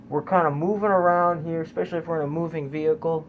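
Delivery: nasal voice through pinched nose; pinching nose. Real, not acted. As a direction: talk with hands on your nose. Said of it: "Excuse me about the, you know, the, uh, nasally voice".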